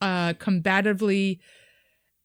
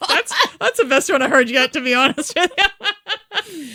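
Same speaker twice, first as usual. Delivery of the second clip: laughing; 7 semitones higher, 6 dB louder than usual. Real, not acted.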